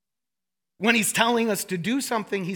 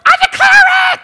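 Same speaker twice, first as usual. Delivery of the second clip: much higher and louder hammed-up woo shout; mock charismatic shout; 16 dB louder, faster than usual. Pastor mocking shouting charismatics, hammed-up loud shout. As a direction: yell it like an overexcited charismatic. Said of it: "we're like".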